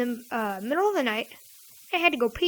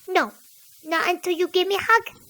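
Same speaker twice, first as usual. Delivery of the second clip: high, insistent child imitation; stubborn kid cousin; 6 semitones higher than usual. Cousin refuses to go back to bed, stubborn kid voice. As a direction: refuse like a little kid holding out. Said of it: "said in that same voice".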